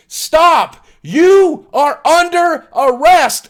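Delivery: high, loud megaphone command; police bullhorn ordering the zookeeper to stop; bullhorn shout. Retold or imitated. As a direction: bark it through a bullhorn, slow on the arrest. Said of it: "a voice through a bullhorn says".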